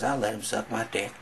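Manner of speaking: ordinary talk, nothing marked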